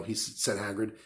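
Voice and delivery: usual speaking voice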